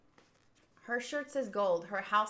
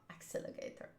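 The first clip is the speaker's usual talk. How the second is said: whisper; 15 dB quieter, faster than usual. Shown as a demonstration. Repeated name under breath.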